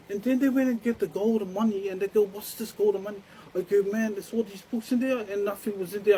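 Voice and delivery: high, loud, heated retold argument; agitated retelling. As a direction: act out an escalating quarrel over missing books. Acting out quarrel over missing books.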